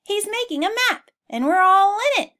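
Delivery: high excited animal shout. Acted. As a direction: shout the news together. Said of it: "quickly shouted".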